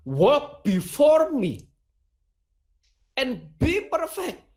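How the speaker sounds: slow, lifted English quote, rising on be; preacher voicing God in English, slow and lifted; solemn divine command. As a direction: pronounce it like God's command, slowly. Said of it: "Kalau dalam versi bahasa Inggris".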